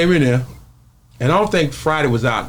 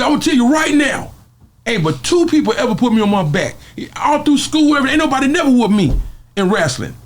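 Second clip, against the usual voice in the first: excited declaring; 11 semitones higher than usual. Retold or imitated. Debo declaring, high.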